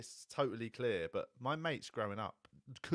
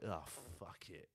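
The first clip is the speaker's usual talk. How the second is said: slow, much softer give-up sigh of words; weary resignation; 5 semitones lower, 11 dB quieter, much slower than usual. Retold or imitated. Describing the national mood, slow soft resigned shrug. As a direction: let it all go, defeated. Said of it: "a general sense of".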